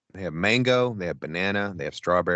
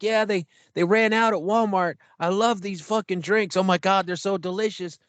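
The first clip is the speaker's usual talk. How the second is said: high, breathless-fan chatter; retold giddy enthusiasm; gushing to cashier; 9 semitones higher than usual. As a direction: gush about a favourite treat, pitched way up.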